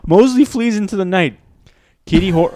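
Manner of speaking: high, loud dramatic narration; high-pitched voice. Acted. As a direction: let the voice climb as you narrate. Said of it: "Why did your voice go so high?".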